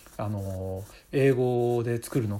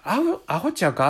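Osaka dialect; 6 semitones higher, 5 dB louder than usual. Retold or imitated. Osaka person teasing a friend, a light Osaka accent. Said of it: "大阪の方が".

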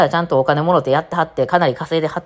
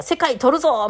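imitated rallying cry; 9 semitones higher, slower than usual. Acting out a captain rallying followers.

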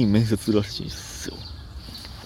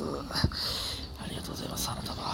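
breathy whisper, much softer; whispered thanks; 2 semitones higher, 13 dB quieter than usual. Shown as a demonstration. Thanking listener, then whispering it. (ささやき声でお礼)

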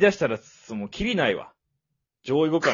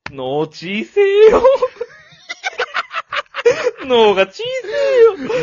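loud, slow yell breaking into laughter; hammed-up yell, laughing; laughing mock shout; 8 dB louder, slower than usual. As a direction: yell it while cracking up. Repeating the comic yell.

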